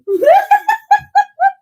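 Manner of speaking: shrieking burst of laughter; high loud laugh. Real, not acted. Streamer cracking up at the chat, shrieking laughter. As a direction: let the laugh break high.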